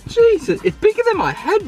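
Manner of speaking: amazed. Real, not acted. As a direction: marvel out loud at the giant pizza. Amazed at the huge pizza.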